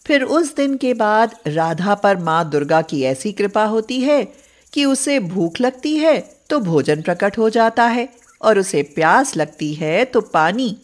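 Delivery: usual speaking voice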